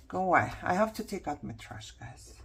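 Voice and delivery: ordinary talk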